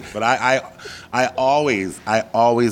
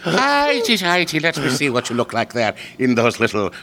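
put-on very high old-Irish-woman brogue; hammed-up Irish, piping up high on the first word; Irish accent; 6 semitones higher than usual. Shown as a demonstration. Showing friend an Irish accent, comes out as old Irish woman. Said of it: "You sound like an old Irish woman".

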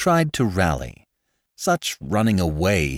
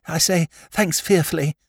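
much higher, eager silly-ass aristocrat voice; flustered young lord; 8 semitones higher than usual. Acted. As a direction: babble it out like a nervous young toff. Lord Drever thanks Jimmy.

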